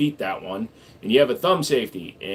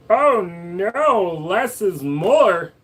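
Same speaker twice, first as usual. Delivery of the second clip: slow mock exclamation; 7 semitones higher, 3 dB louder, much slower than usual. Slow high mock line.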